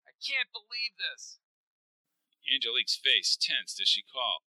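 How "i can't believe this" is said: high, soft anguished voice; pitched up, reeling from the news; grief-struck and high; distraught disbelief. Acted. Mark in shock over death.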